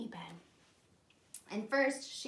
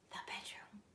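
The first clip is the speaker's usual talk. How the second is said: hushed, secretive answer; whispered hint; 2 semitones lower, 11 dB quieter than usual. A narrator's reading.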